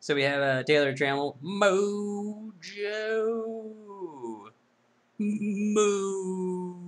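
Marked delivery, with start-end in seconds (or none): 1.4-6.8 silly drawn-out voice. Acted. Drawn-out funny naming.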